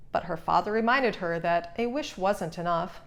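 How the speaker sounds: plain reading voice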